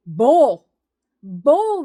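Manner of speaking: one loud furious word; angry shout. Real, not acted. Rejecting the government's claim, a loud angry shout. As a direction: bark it out in anger.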